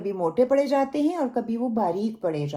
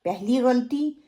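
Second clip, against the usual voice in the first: ordinary delivery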